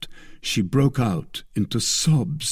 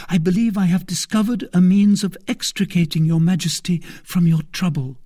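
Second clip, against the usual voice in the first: high, fervent pledge; devoted young woman; 9 semitones higher, 4 dB louder than usual. A narrator's reading. Maid pledging herself to the queen, fervent voice. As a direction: swear your loyalty.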